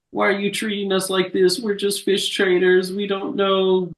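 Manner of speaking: high-pitched, imitated crying plea; mock tearful pleading. Retelling a crook's tearful denial to police, mock crying. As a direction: plead in a high, put-on weepy voice. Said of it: "starts crying and being like".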